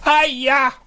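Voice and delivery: bright, high greeting; a cheery high hiya; squeaky excited hello; exclaiming. Acted. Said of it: "exclaimed the mare".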